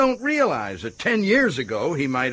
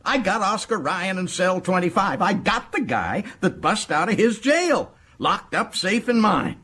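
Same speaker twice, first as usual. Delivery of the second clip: high, crowing excitement; high-pitched gloating; triumphant boasting; 5 semitones higher than usual. Acted. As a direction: brag gleefully, near laughter. Boasts of the capture.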